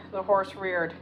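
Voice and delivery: usual speaking voice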